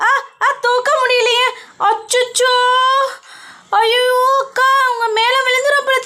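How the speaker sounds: squeaky high strained shrieks; high pitched panicked sister scene; high cartoon sister voices. Sisters squabbling over a spilled bucket, squeaky high voices.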